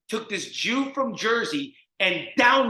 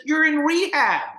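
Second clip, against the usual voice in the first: high, loud incredulous outburst; exasperated counselor shout; 10 semitones higher, 7 dB louder than usual. Retold or imitated. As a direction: imitate a counselor yelling the obvious. Counselor's outburst quoted again.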